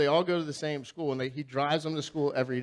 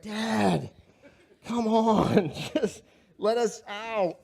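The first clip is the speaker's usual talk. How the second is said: embarrassed daughters; 6 semitones higher, much slower than usual. Retold or imitated.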